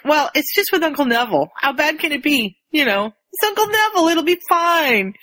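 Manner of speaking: high-pitched breezy reassurance; imitated Albus shrugging it off. Albus downplays detention with his uncle, high breezy voice. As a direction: pitch it up, stretch the last words. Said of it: "Albus is like".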